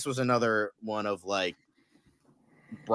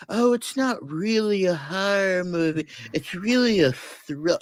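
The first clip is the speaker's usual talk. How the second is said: sing-song higher mock of a know-it-all critic; mocking quote; 10 semitones higher than usual. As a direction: sneer it like a snob dismissing horror. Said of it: "where it's like".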